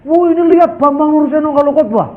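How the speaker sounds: high-pitched mimicked jibe; mocking criticism. Pastor needling the preacher over foreign words in sermons, mocking imitation. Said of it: "Jadi saya ini disindir".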